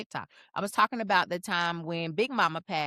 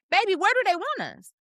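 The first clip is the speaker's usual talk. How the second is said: sassy comeback; 10 semitones higher, 3 dB louder than usual. Real, not acted. Sassy retort to online comment.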